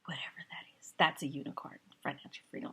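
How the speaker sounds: soft unvoiced aside about a unicorn; whispered joke aside. Real, not acted. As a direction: drop to a whisper, dismissive. Muttered sceptical aside.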